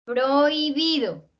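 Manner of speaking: "Prohibido" slowly, oi as one glide; vowels kept together. Shown as a demonstration. Teacher showing vowels that stay joined, "Prohibido" slow and gliding. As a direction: draw out "Prohibido" without splitting oi. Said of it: "aquí no se separan".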